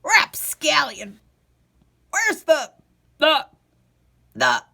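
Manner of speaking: holler, then lose the word; forgetful old man hollering at the rapscallion. Acted. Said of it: "hollered out".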